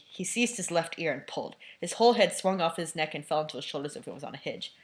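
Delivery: ordinary delivery, nothing marked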